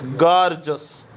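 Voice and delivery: गॉर्जस as gor-jus, two syllables; correct pronunciation. Shown as a demonstration. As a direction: say गॉर्जस, never gor-jee-us. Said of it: "गॉर्जियस नहीं पढ़ोगे". (गॉर्जस दो हिस्सों में, गॉर-जस)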